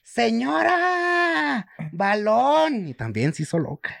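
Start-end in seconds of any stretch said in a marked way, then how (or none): 0.0-2.9 slow stretched-out "Señora"; kids calling out, drawn out. Retold or imitated. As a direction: drag the call like a bored kid. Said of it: "niños llamando a la vecina". (niños llamando "Señora, balón" a la vecina, alargado)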